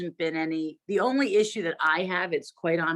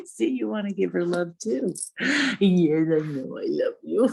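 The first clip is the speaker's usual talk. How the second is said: talking to a dog. Real, not acted. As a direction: murmur sweet nothings to the pet. Cuddling and praising the dog.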